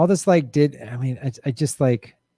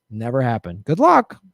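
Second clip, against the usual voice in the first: normal talking voice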